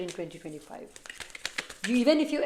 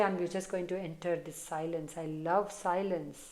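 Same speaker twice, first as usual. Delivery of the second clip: softer and lower, savoring silence; blissful quiet; 3 semitones lower, 8 dB quieter than usual. Retold or imitated. Viewer imagined embracing silence, speaking low and soft. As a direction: murmur as if already at peace. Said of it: "You're going to say".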